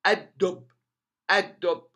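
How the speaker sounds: "الدب" as ad-dubb with a silent lam; strengthened dal. Shown as a demonstration. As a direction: lean on the doubled d in "الدب". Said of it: "the dal becomes strengthened". ("الدب" بدال مشددة ولام صامتة)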